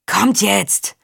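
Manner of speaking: breathless guard's urging; gasping. A narrator's reading. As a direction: gasp out the order. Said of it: "keuchte der Wächter". (keuchend gedrängt)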